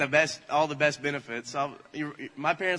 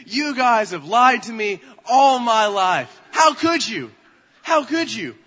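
loud, high mock-outraged accusation; confronting; 6 semitones higher, 10 dB louder, slower than usual. Child confronting parents over the lie, outraged. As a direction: cry out betrayed, drawn out and hammed up. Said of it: "I confront them".

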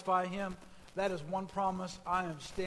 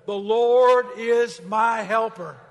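slow, very loud and high; loud group declaration; 4 semitones higher, 14 dB louder, slower than usual. Shown as a demonstration. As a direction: lead the congregation in it. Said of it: "Let's say it together".

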